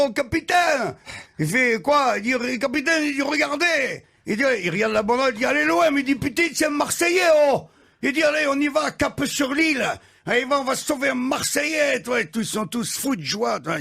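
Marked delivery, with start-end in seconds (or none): 0.1-4.0 higher excited call. Acted. Sailor calling out to captain. 5.5-12.0 high, thrilled cries; rally the crew with delight; captain overjoyed to save a fellow Marseillais; joyful shouting. Acted. Captain overjoyed spotting fellow fan.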